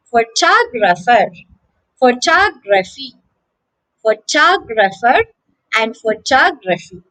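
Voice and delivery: pho-TOG-rapher, pho-TOG-raphy; short vowels around a stressed TOG in "photographer", "photography"; second-syllable stress. Shown as a demonstration. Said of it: "the stress is on the second syllable".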